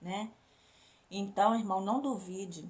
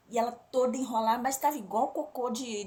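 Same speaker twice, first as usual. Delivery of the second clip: ordinary delivery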